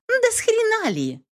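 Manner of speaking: high outraged woman; indignant female voice. A narrator's reading. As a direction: squeal it in outrage. Katherine outraged at narrator.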